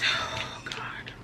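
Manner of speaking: very soft breathy whisper near tears; tearful whisper. Real, not acted. Overcome with emotion, whispering to herself. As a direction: breathe it out, about to cry. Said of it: "Just know that this is my cycle".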